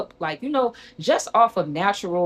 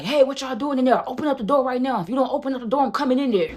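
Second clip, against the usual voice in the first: higher-pitched urgent guard calling out; security guard; 7 semitones higher than usual. Retold or imitated. Security guard at the dorm door ordering them to open up, high urgent call. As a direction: imitate a guard demanding entry. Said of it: "security guard, he's like".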